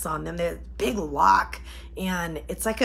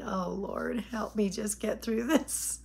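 softer, pleading under her breath; a quiet plea to the Lord; muttered prayer; 9 dB quieter than usual. Retold or imitated. Desperate mom praying to herself, soft and pleading.

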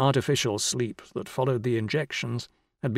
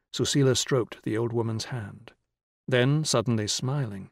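plain reading voice

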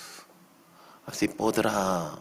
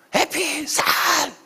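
very high, loud yell; shouting; 5 dB louder, slower than usual. Retold or imitated. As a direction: shout someone out of the room. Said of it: "Como así grito". (grito fuerte y agudo)

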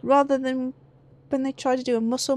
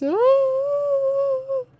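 startled high yelp; 13 semitones higher, 5 dB louder than usual. Real, not acted. Startled by monsters.

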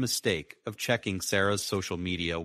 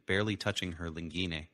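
ordinary delivery

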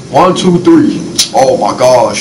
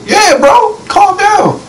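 excited; much slower than usual. Real, not acted. Teammates screaming in the game.

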